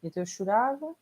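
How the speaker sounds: usual speaking voice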